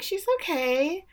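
high, slow and unsure; hesitant answer. Retold or imitated. Acts out unconvincing answer.

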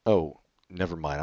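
ordinary talk

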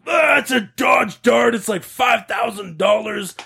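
high, slow whiny yell; mock tantrum holler; imitated shouting. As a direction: wail it like an outraged teenager. Acting the screaming kid.

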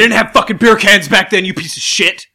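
loud, high mock fury at his friend; mock outraged yell. Yelling at his cohost over the beer can story, mock outrage. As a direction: shout him down in joking outrage.

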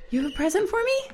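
high, delighted surprise with a gasp; excited. Real, not acted. Handed a surprise present.

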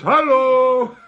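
loud high hello; husband hailing the wives as he enters; calling out. Acted. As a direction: call out to the wives. Men calling to their wives.

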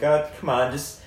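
mock girlfriend pleading; coaxing girlfriend. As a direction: sell it like a girlfriend talking him into going.

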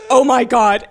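loud, stunned "oh my God"; a retold jaw-drop moment; shocked gasp. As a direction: blurt it out in disbelief.

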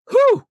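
big high exhale of relief; relieved whew. Real, not acted. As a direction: blow out a loud whew. After rattling off long list.

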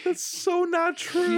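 high, drawn-out denial; affronted squeal of disagreement; indignant protest. Real, not acted. Mock protest at teasing.

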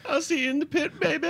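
high squeaky clown voice, honking; goofy circus clown; silly clown. Acted. S2 does a silly clown bit.

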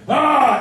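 sharp, high, loud strained yell; throaty shout. Retold or imitated. Voice actor showing the shout he gave the director, high and loud. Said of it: "when I shouted, I'd be like".

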